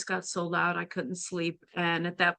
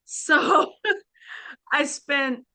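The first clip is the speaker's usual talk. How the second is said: talking through laughter, high and slow; laughing; 7 semitones higher, 3 dB louder, much slower than usual. Real, not acted. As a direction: laugh your way into the next sentence. Laughing at her own anecdote.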